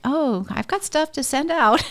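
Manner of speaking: high-pitched mock husband voice; eager husband. Husband volunteering his things for the cleaners, high mock-eager voice. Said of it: "even my husband is like".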